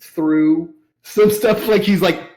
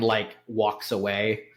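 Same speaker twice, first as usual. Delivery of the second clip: ordinary talk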